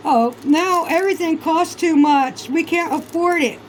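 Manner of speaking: high, whiny, put-upon mimicry; mock whiny complaint. Mocking Mexicans complaining about costs, in a high whiny mimic. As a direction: whine it like a sulky complainer.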